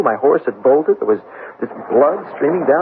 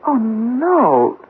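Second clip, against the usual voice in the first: higher, breaking with dismay; the truth hits him hard; anguished cry; 9 semitones higher than usual. Acted. Charlie learning his letters vanished, anguished cry. Realises letters were stolen.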